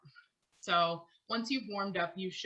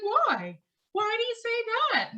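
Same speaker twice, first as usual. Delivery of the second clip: much higher, louder head-tone voice, imitated; weird head voice; 8 dB louder than usual. Singer innocently asking coworkers why, in a much higher head voice. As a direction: speak up in a floaty, lifted head voice. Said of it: "that weird voice on".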